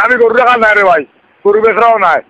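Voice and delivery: ordinary delivery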